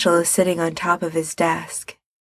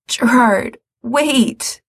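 urgent cry, higher; urgent call; 5 semitones higher, much slower than usual. Acted. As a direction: call him back in alarm. Mikey calls him back.

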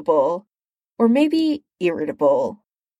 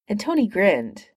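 ordinary delivery, nothing marked

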